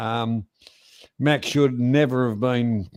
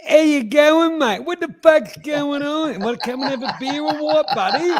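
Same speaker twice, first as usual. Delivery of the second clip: hammed-up Australian bloke, much higher and louder; broad Aussie accent; 4 dB louder than usual. Showing his friend how Australian mates greet each other, in an exaggerated Australian accent. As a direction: lay the Aussie twang on thick, mate. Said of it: "I can bloody talk really Aussie".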